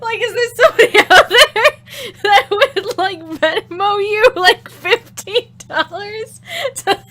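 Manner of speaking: high and loud, breaking into laughter; can barely get it out for laughing. Real, not acted. Streamer asks chat a gross question while cracking up.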